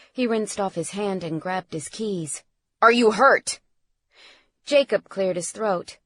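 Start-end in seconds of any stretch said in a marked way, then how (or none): 2.8-3.6 loud, worried question; alarmed, raised voice; urgent concern. A narrator's reading. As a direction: demand to know he's safe. Father urgently checks son for injury.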